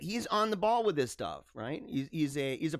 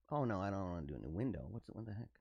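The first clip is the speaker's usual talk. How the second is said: muttered aside; 9 semitones lower, 11 dB quieter, much faster than usual. Real, not acted. Muttering at the window mid-stream.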